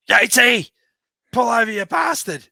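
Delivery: high, loud mock call across the road; shouting out. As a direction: yell him over cheekily. Calling out to rider on road.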